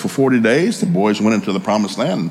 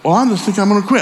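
high-pitched imitation of an upset daughter; 8 semitones higher, faster than usual. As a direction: put on a high, indignant daughter voice. Daughter insists on quitting.

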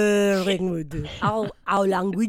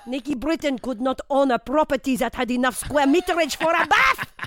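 lightly hammed French accent; a comic Frenchman mocking a comedian. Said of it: "Pierre Jambon".

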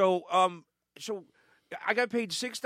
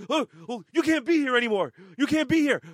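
flustered boss; 6 semitones higher, 6 dB louder than usual. Retold or imitated. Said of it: "he goes".

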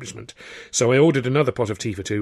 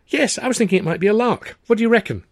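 much higher and louder, breezy; eager Tilly; 12 semitones higher, 7 dB louder than usual. A narrator's reading. Tilly to Arthur calling marriage a lark, high and breezy. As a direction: toss the idea off as a lark.

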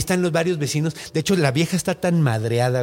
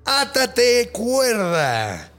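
witch command; 7 semitones higher, much slower than usual. Acted. Witch commands magic rope.

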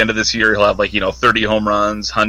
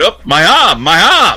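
pained cry; 8 semitones higher, 4 dB louder than usual. Retold or imitated. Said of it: "they'll put him in there".

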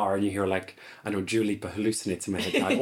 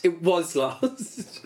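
slow drawn-out mock drama; 6 semitones higher, much slower than usual.

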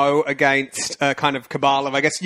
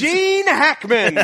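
laughing; 8 semitones higher, much slower than usual. Real, not acted. Laughing guess at guest.

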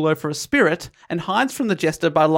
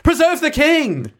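high, drawn-out shout; yelled alarm; 11 semitones higher, 4 dB louder, slower than usual. A narrator's reading. Gonzalo waking to protect the king, yelling. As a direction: yell it way up high, stretching the words. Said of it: "Gonzalo sits up and yells".

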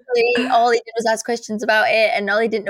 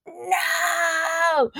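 dramatic cry; 7 semitones higher, 3 dB quieter than usual. Retold or imitated. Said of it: "It was so dramatic".

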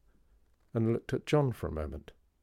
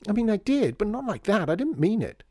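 high, loud, flustered self-defence; flustered protest; 13 semitones higher, 7 dB louder than usual. A narrator's reading. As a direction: plead your case, high and loud. Child defends herself in quarrel.

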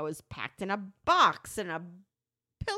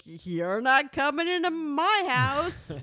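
higher and louder, slow, growled-out wolf threat; mock big bad wolf; 5 semitones higher, 4 dB louder, slower than usual. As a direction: play the wolf guarding his den, drawn out. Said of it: "you're literally like the big bad wolf".

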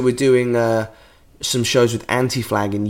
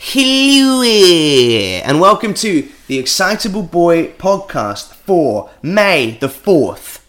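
big, drawn-out announcer greeting; high, loud hello easing into a slow welcome; podcast intro; 5 semitones higher, 5 dB louder, much slower than usual. Acted. Host opens the Excitable Boy podcast, drawn-out announcer style.

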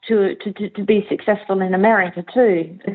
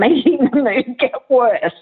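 laughing; 5 semitones higher than usual. Real, not acted. Laughs at the irony.

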